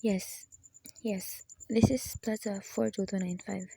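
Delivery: hushed, breathless whisper; fearful whisper. Acted. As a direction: barely dare to speak it. Answers telegram fearfully.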